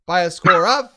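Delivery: usual speaking voice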